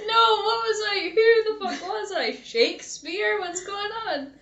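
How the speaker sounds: high, helpless laughing disbelief. Real, not acted. Reacting to own old message.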